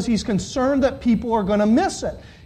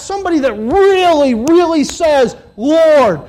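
REALLY, REALLY stretched and loud, then a full LORD; "Lord" called out loud with feeling; emphatic emotion; 5 semitones higher, 9 dB louder than usual. Shown as a demonstration. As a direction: lean hard on "really, really says". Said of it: "really says it with a lot of emotion".

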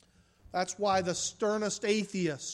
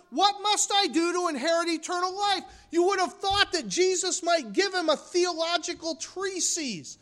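loud, higher preaching; full-force preaching; 7 semitones higher, 7 dB louder than usual. Acted. Pastor telling the congregation about the rich man, preaching loudly. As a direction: drive the story home loudly.

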